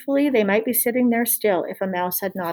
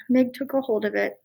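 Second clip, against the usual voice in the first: usual speaking voice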